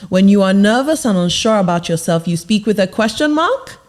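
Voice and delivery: rising at the end on "question mark". Shown as a demonstration. Coach showing how nervous people speak, ending in a question. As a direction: lift "mark" up like an unsure question. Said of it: "difference in my pitch and my tone".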